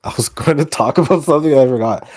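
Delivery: loud embarrassed laugh through words; laughing at himself. Real, not acted. Forgets topic, laughs.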